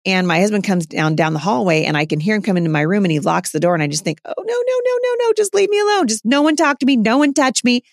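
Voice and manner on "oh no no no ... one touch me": much higher whiny pleading, rising into a wail of oh no; dreading whine. Retold or imitated. Exhausted mom inwardly begs her husband to leave her alone, high whiny dread. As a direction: whimper it like a worn-out mom begging to be left alone.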